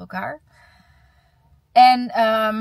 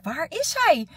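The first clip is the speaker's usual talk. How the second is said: panicked; 8 semitones higher, 3 dB louder, slower than usual. Retold or imitated. Retelling missing pupil panic.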